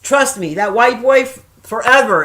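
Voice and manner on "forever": ordinary delivery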